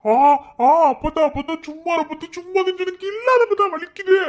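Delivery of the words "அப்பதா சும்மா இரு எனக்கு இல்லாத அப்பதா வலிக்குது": high, loud pained whine; whining in pain. Acted. Husband whining that his wife's pinch really hurts, high and loud.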